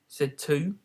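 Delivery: usual speaking voice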